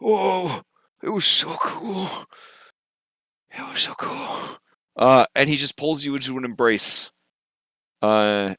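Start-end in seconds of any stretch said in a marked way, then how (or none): 0.0-2.1 high, softer, winded gushing; awed excitement. Acted. Bullbug winding down his tale to Phil, awed excitement. 3.5-4.6 soft, spent near-whisper; hushed awe. Acted. Bullbug to Phil after his tale, hushed awe. Bullbug overwhelmed, about to weep.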